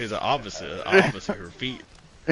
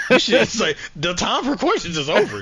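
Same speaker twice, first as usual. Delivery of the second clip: mock rant; 8 semitones higher, 7 dB louder than usual. Acted rant.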